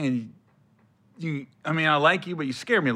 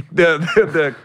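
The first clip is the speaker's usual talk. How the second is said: normal talking voice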